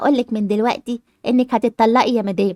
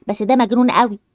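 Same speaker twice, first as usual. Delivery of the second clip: ordinary talk, nothing marked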